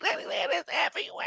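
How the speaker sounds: very high, soft, laughing; laughing squeak. Real, not acted. Host laughing high at viewer Fred. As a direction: giggle up high.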